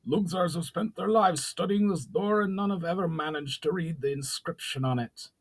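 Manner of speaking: a fat council goblin objecting. A narrator's reading. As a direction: protest as a scandalised goblin.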